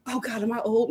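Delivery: usual speaking voice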